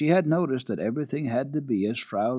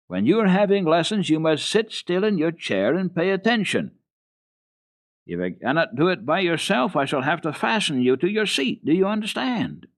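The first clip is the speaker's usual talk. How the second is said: high, loud, stern governess; strict governess; 8 semitones higher, 5 dB louder than usual. Acted. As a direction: lecture the child firmly. Rottenmeier lecturing the child.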